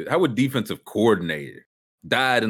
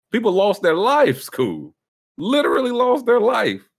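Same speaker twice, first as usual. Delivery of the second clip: indignant; 12 semitones higher, 6 dB louder than usual. Real, not acted. Outraged about deaths.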